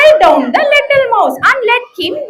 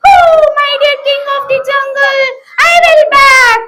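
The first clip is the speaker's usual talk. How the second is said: high, eager comforting mouse; reassuring mouse; 6 semitones higher than usual. Acted. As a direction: reassure the trapped king. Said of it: "heard the lion's voice and ran".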